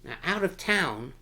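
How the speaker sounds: ordinary delivery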